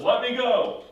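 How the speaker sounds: loud, high cry to be released; demanding shout. Retold or imitated. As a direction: shout it as the struggling wrestler. Angel struggling in wrestling match.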